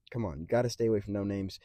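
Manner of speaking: usual speaking voice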